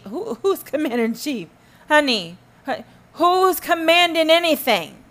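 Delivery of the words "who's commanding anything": high, loud scorn; mock the idea of commanding; incredulous loud scoff; scornful question. Real, not acted.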